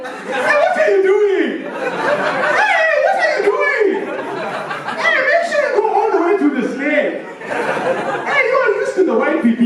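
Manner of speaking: high, laughing call-outs; mock Nigerian guy. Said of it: "a Nigerian guy's like".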